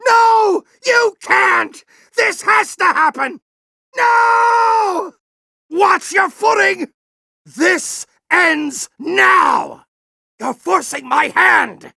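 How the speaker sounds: desperate yell. Acted. General yelling at Ratchet to stop him. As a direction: scream it out in desperation. Boss fight, desperate outbursts.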